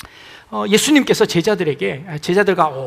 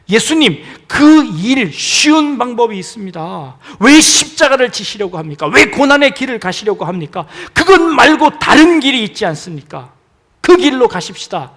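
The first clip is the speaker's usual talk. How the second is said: loud, higher insistent coaxing; urgent pleading temptation; 5 semitones higher, 11 dB louder than usual. Acted. Peter tempting Jesus toward an easier path, loud urging. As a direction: press him toward the easy way.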